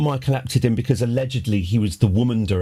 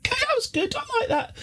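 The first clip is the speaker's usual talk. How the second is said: much higher, laughing squeals of delight; mock delighted viewer; 4 dB quieter, faster than usual. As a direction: ham up a fan spotting the good bit, squeaky and giggly. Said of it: "you're like".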